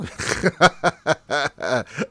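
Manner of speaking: bright burst of laughter; a quick amused laugh. Real, not acted. Laughs at a non-question.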